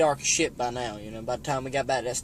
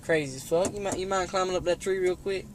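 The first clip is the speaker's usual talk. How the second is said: high, put-on wheedling buddy voice; coaxing buddy; 5 semitones higher than usual. Buddy begging narrator to climb for arrow, high coaxing voice. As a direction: sweet-talk him up the tree in a raised voice. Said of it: "And he's like".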